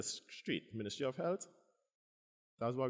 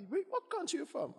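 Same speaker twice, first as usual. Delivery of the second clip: mock curious doctor; 3 dB louder than usual. Doctor asking origin.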